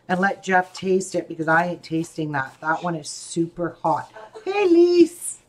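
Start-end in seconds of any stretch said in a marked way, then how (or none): 4.5-5.4 high, loud call across the room. Real, not acted. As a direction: call her name loudly from afar. Calling out to someone nearby.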